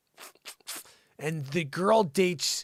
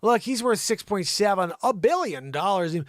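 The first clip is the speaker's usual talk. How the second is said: ordinary talk, nothing marked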